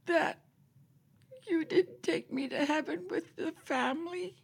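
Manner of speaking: high whispered sobbing plea to Jesus; tearful child's prayer. Retold or imitated. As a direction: pray like a crying little girl begging not to be left behind. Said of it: "I cried and cried".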